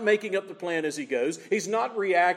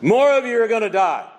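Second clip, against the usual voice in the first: louder, ringing declaration; booming voice of God; 4 semitones higher, 9 dB louder than usual. Acted. Preacher giving God's stern answer, loud and ringing. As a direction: proclaim it like a verdict from heaven.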